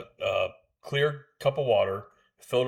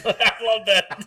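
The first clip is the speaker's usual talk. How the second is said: high, loud laugh through the words; real delighted laughter, slowed speech; 10 semitones higher, 5 dB louder, slower than usual. Real, not acted. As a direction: crack up while talking. Cracking up at accent trick.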